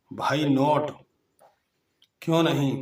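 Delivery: teacher calls out the next phrase to the class, loudly; emphatic announcing. Shown as a demonstration. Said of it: "तो इसकी English आ जाएगी".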